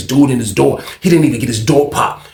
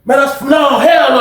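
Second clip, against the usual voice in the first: high-pitched indignant outburst; retold hollering in outrage; loud protest; 10 semitones higher than usual. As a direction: imitate a young guy loudly objecting. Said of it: "He get loud as a bitch".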